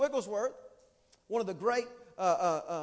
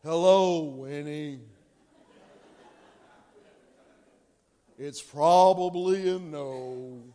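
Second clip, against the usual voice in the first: lower, slow, glum donkey voice; Eeyore; 9 semitones lower, 5 dB louder, much slower than usual. Retold or imitated. A mopey Eeyore greeting Winnie, low and slow. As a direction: drag it out like a gloomy donkey. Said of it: "I feel like Eeyore".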